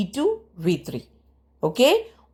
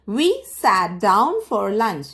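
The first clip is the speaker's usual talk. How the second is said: slow model reading; much slower than usual. Shown as a demonstration. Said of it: "teacher models English sentence".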